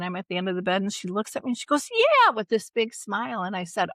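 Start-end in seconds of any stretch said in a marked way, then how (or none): 1.9-2.3 high, loud, bright yes; eager yes. Retold or imitated. Mother happily agreeing to go water skiing, high and eager. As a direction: blurt it with a big smile. Mom's happy reply quoted.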